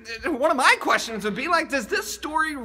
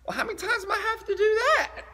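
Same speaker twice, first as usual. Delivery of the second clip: high, laughing exasperated whine; 9 semitones higher, faster than usual. Retold or imitated. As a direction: groan it out like it's too much. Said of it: "Peter's first thought is like".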